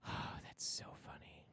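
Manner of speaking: sighing whisper. Real, not acted. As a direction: breathe it out, amused. Whispered sigh.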